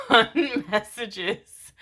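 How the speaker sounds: slowly laughing through the words. Real, not acted. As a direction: laugh while talking. Laughs through reading title.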